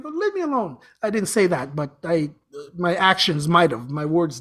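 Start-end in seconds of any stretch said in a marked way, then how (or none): 0.0-0.8 high, soft plea to be left alone; mock protest. Brushing off the spiritual guide's urging to meditate, mock protest. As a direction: wave off a nagging voice in a higher, softer tone. Retelling a retort to nagging guide.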